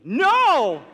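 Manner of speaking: high, very loud cry of no; yelled refusal. Retold or imitated. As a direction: shout it in mock horror. Refusing dad's request to come.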